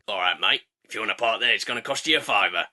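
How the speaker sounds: low, geezer-ish crow voice; a shifty car-park hustler crow; dodgy crow. Acted.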